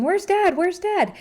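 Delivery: put-on high, loud child's question; a kid asking for dad. Child asking the parent where dad is, high voice. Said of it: "Maybe your kid's asking".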